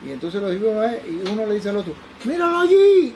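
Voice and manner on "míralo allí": high, loud yell of discovery; imitated shouting. Drunk man spotting him across the cafeteria, imitated shout. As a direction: holler it across a crowded room. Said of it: "Pero gritaba ahí en el medio".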